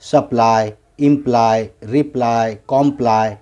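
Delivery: सप्लाई, इम्प्लाई, रिप्लाई, कॉम्प्लाई each ending in eye; final y as eye. Shown as a demonstration. Teacher models words in ply, सप्लाई to कॉम्प्लाई ending in eye. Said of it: "वाई, आई की आवाज़ निकालेगा".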